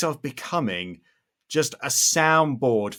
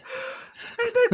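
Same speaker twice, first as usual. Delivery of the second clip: high laugh. Real, not acted. Laughs at the joke.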